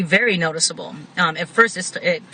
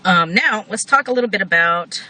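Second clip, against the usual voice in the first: ordinary talk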